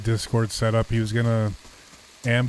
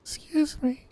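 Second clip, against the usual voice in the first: high falsetto lady voice, a man in disguise; fake lady voice; 12 semitones higher, 5 dB quieter, much slower than usual. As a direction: squeeze it up into a fake woman's voice. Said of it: "Disguise your voice like a lady?".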